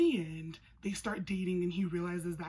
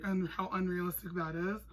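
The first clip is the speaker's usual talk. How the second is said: usual speaking voice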